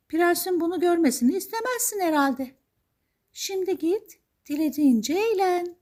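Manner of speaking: very high, lilting magical lady voice; high-pitched storybook fairy; fairy godmother. Acted. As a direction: warn and send off in high fairy tones. Fairy godmother warns Cinderella.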